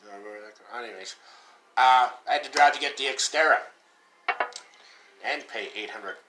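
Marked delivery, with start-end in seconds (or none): none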